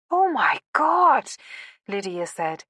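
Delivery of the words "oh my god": high, slow, drawn-out amazement; stunned. A narrator's reading. Woman learning he is the pilot's grandson, stunned. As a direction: let each word swell with astonishment. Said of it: "her heart racing".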